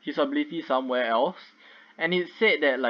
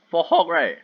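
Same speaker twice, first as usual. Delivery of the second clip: voice crack; 3 semitones higher, 4 dB louder than usual. Real, not acted. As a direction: voice cracking on Hulk. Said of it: "Oh my god, voice crack".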